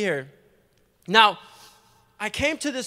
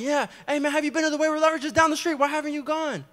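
higher, fast eager pitch; eager invite; 6 semitones higher, faster than usual. Retold or imitated. Inviting bank tellers to church, high fast eager pitch. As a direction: sell it like an excited recruiter. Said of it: "I'm like".

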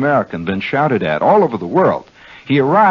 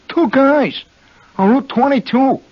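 New Jersey accent; 3 dB louder than usual. Shown as a demonstration. Host shows the native way to name the store, high Jersey accent. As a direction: stretch "two guys" in a raised Jersey accent. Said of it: "that's the correct pronunciation".

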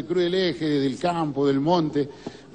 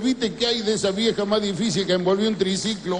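normal talking voice

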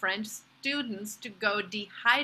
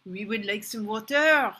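French learner of English ordering water; French-accented request; 4 dB louder than usual. Acted. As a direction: ask politely with a French accent. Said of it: "so you try again".

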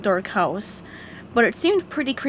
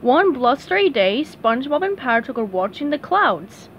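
ordinary delivery, nothing marked

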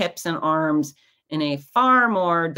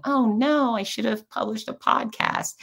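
higher-pitched, mock-woeful wail; mock dismay; 7 semitones higher than usual. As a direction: fret melodramatically over a missed duty. Mock dismay lying sick in bed.

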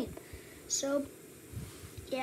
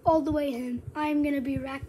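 ordinary talk, nothing marked

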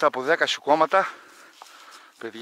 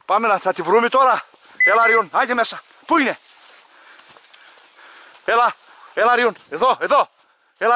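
loud, higher urging to search; calling the dog; 4 semitones higher, 9 dB louder than usual. Real, not acted. Hunter loudly urging his dog to find the shot bird.

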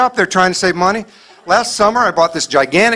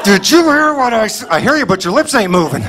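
ventriloquist mom threat; 2 semitones higher than usual. Retold or imitated. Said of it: "you do that ventriloquist thing".

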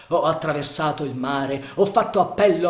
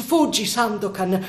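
higher, loud urgent plea; repeated cry to flee; 7 semitones higher, 5 dB louder than usual. Acted. Marianna urges Sandokan to escape, loud high cry. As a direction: beg him to run now. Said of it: "Ripeté Marianna".

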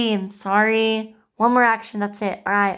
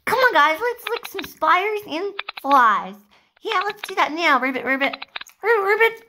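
higher, perky frog voice; rally the frogs in a raised little voice; eager play frog; 7 semitones higher than usual. Acted. Frogs off to lick spiders and flies, eager frog voice.

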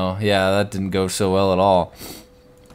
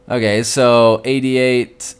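ordinary delivery

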